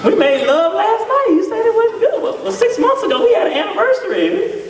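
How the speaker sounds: high, stunned disbelief; flustered husband. Retold or imitated. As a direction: squeak it out like a bewildered husband. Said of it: "and you be like".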